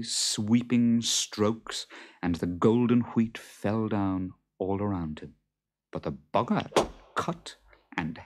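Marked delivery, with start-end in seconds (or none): none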